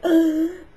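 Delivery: sharp high mock gasp; startled gasp. Teacher finds the girl at the window, mock gasp. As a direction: gasp like a man jumping back in fright. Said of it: "he jumped back like".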